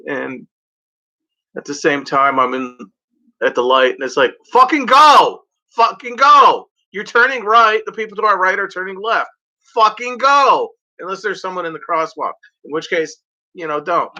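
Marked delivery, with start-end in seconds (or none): 4.5-10.7 high, loud frustrated yelling; mock road rage, shouting. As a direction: yell at the car ahead to go.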